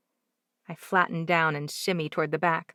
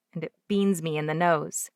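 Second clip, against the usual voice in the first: usual speaking voice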